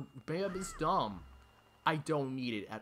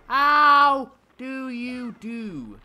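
high, loud, drawn-out wail; exasperated shout; 10 semitones higher, 15 dB louder, much slower than usual. Real, not acted. Gamer baffled by the puzzle, exasperated shout. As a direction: stretch the words out in loud exasperation.

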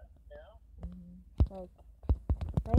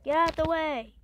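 shouting; 4 semitones higher, 8 dB louder than usual. Real, not acted. Yelling at game mobs.

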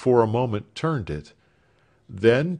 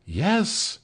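eagerly; 9 semitones higher than usual. Acted. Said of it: "imposed the elder sister eagerly".

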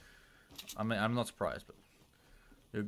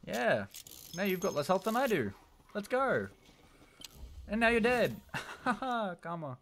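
taunting; 11 semitones higher, 3 dB louder than usual. Real, not acted. Beating an opponent in game.

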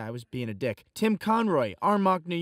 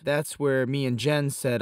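usual speaking voice

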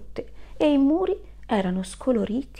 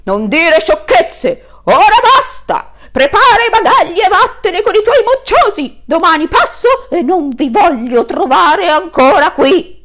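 furious landlord; 9 semitones higher, 14 dB louder than usual. Acted. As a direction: yell the eviction. Landlord threatens eviction.

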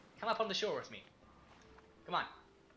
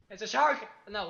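surprised; 5 semitones higher, 9 dB louder than usual. Real, not acted. Sees shark, startled.

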